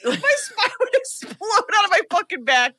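high-pitched words through real laughter; laughing while talking. Real, not acted. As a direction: laugh your way through the absurd claim. Joking about exploding spine.

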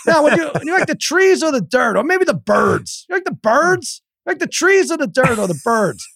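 high, badgering mock voice; relentless nosy friend. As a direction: keep pestering the kid. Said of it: "He's like".

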